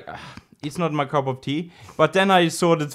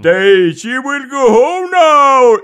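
high, loud, drawn-out exclamation; mock-excited crowd; 8 semitones higher, 10 dB louder, much slower than usual. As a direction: proclaim it like an amazed onlooker. Everyone exclaiming in retold film plot.

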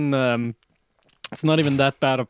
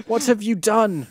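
angry; 7 semitones higher than usual. A narrator's reading. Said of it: "Dumbledore walked in angrily".

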